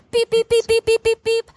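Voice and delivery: high, loud beep beep beep; imitated beeping monitor. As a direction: mimic a hospital alarm.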